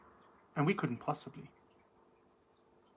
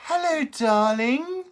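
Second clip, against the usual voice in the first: high, slow sing-song hello darling; camp drag greeting; 8 semitones higher, 6 dB louder, slower than usual. Real, not acted. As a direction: draw out the hello like a glamorous drag queen.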